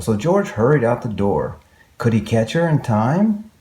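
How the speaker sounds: ordinary delivery, nothing marked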